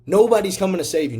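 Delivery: rising to a fired-up shout on the repeat. Real, not acted. Repeated louder and higher.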